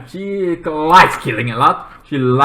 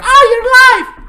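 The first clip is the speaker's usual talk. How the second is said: very high, loud glad cry; joyful surprise; 10 dB louder than usual. Retold or imitated. Charlie seeing Sarah wake up, high joyful cry. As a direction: gasp it out in delight.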